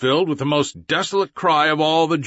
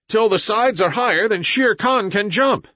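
shouted order; 7 semitones higher than usual. A narrator's reading. Mowgli shouts to Gray Brother over the running herd, raised voice. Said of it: "shouted Mowgli".

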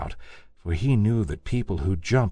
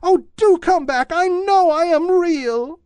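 high, louder calling rabbit voice; little rabbit calling; 6 dB louder than usual. A narrator's reading. Toy rabbit calling after the vanished rabbits, high call. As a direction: call after them like a lonely toy bunny. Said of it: "called the little rabbit".

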